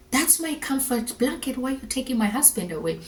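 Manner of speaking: re-enacted plea, lightly distressed and hurried; mild distressed protest. Wife asking why husband is taken away, distressed.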